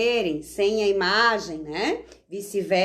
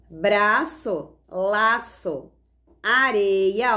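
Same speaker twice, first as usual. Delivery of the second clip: each word said slowly and set apart; reading rhyming pairs; much slower than usual. Shown as a demonstration. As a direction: stress the matching endings of the rhymes. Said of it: "Olha só". (pares de rima lidos com ênfase nas terminações)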